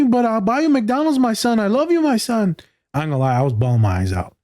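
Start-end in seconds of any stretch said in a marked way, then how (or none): 0.0-2.6 much higher, earnest imitation of a loving dad; imitating the podcast dad. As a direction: pitch way up and plead simply. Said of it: "He's like".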